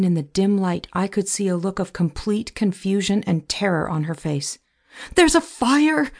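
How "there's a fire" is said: high, loud cry of alarm; scream. A narrator's reading. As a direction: scream a fire warning, pitched well up. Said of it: "I screamed".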